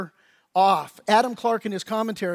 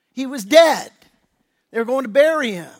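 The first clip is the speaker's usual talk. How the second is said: emphatic; 3 semitones higher, 8 dB louder than usual. Real, not acted. Preacher emphasis.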